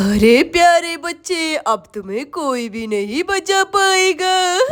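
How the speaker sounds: slow, drawn-out witch voice; gloating witch. Acted. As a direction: taunt the prey like a storybook witch. Witch threatens caught child. (शिकार को चिढ़ाती चुड़ैल की धीमी आवाज़)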